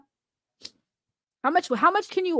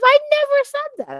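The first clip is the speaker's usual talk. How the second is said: mock innocent squeal; 12 semitones higher, 4 dB louder than usual. Denying the HIV joke to Lunchbox, high mock innocence. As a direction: pitch jumps way up in fake denial. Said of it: "I totally did say that".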